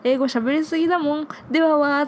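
high, excited fan-mail voice; upbeat, hurried, loud sign-off; positive energy. Acted. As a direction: finish high and loud with a playful burst. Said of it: "[name]さんのこのポジティブなエナジー".

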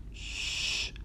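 soft drawn-out "shhh"; long voiceless shush. Acted. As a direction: hush someone who swore. Someone shushes the swearing.

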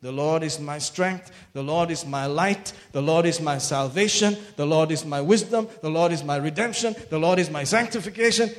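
bold declaration. Shown as a demonstration. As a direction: proclaim each line with growing force. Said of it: "So just say".

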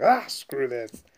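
high, loud, drawn-out mock huff; exasperated outburst; acted exasperation. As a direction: imitate someone fed up. Acting her storming off.

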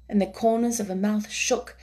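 usual speaking voice

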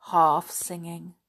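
ordinary delivery, nothing marked

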